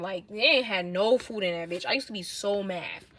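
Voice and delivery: ordinary talk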